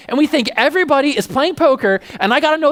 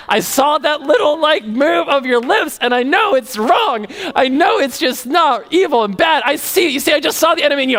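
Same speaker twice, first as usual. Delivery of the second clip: mock zeal, stretched words rising higher; triumphant accuser; 4 semitones higher than usual. Preacher playing someone sure they saw the enemy, mock-triumphant.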